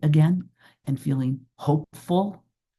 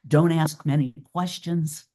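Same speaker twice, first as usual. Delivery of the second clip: normal talking voice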